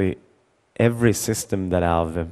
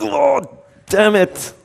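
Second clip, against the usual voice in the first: put-on much higher, louder kid groan of defeat; kid upset; 6 dB louder, slower than usual. Son losing his dad's game, high frustrated kid cry. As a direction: cry out like a boy who just lost.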